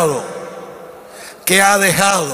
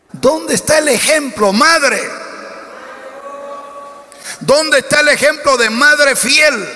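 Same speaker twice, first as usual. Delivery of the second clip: high-pitched pulpit challenge; impassioned, high preacher's cry; full-force preaching; 8 semitones higher, slower than usual. Real, not acted. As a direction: demand an answer from the congregation, pitch raised high.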